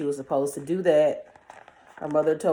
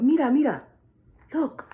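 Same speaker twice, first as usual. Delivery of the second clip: urgent birds; 9 semitones higher, faster than usual. Acted. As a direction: cry out to make her look. Birds urging again.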